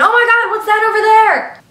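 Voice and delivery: loud high mock alarm, pointing away; fake shock. Girl fakes alarm to distract Captain Murderer, high loud mock shock. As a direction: fake a big gasp of alarm to distract him.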